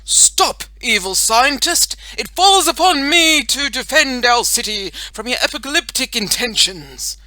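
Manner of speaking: loud, high, declamatory hero challenge; brave superhero voice. A narrator's reading. Boy superhero confronts the evil scientist, boldly heroic. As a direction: proclaim it like a caped champion. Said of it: "in his brave superhero voice".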